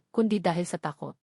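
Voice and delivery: plain reading voice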